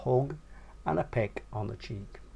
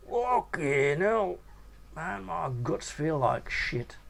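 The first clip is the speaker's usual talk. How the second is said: 1 queasy groan; 5 semitones higher, slower than usual. A narrator's reading. Sick Nobby complains.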